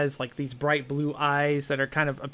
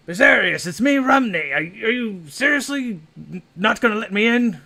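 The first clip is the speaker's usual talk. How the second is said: high, loud exasperated calling; calling through door; 7 semitones higher, 6 dB louder than usual. Acted. Rumney calls to his friend through the locked door, loud and high. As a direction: plead loudly through the door. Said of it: "knocking, pleading through door".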